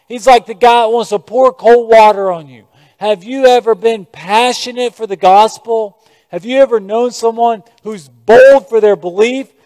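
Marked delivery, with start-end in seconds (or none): none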